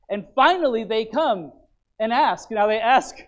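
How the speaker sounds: fervent sermon build-up; preaching. Acted. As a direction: drive the point home from the pulpit. Preaching passage builds in force.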